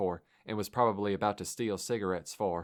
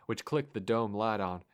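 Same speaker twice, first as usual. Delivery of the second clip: usual speaking voice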